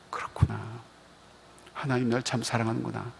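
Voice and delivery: hushed, soft "그렇구나"; tender reassurance. Shown as a demonstration. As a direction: comfort a hurting soul gently. Pastor comforting a struggling person. (힘든 사람을 위로하듯 속삭이듯 부드럽게)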